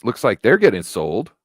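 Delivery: normal talking voice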